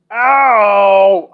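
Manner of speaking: sharp loud yelp of pain; loud cry of pain. Acted. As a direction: yelp as the locker hits your head. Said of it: "I hiss in pain".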